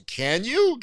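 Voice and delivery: high, loud questioning squeal; incredulous challenge. Retold or imitated. Host doubting co-host's confidence about topics, incredulous. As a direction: throw it back in disbelief.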